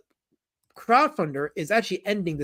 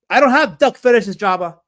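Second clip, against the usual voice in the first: raised voice, punching "don't"; loud indignant denial; 4 semitones higher, 8 dB louder than usual. Real, not acted. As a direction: loudly deny it to the teasing chatter. Loud denial to teasing chatter Jabba.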